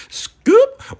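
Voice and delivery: high, loud scoop call; loud hype shout. Acted. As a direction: yell it like a hype man. Hyping his own scooping.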